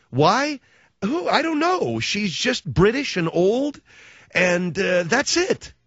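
high-pitched incredulous back-and-forth; mock exasperation. Comedian questioning Judi Dench's award, high mock bafflement. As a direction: pitch jumps up, baffled by the choice.

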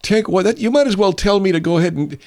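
worked-up sarcastic comeback; indignant retort. Acted. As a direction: throw the suggestion back in outrage. Acted quarrel over taking a vacation.

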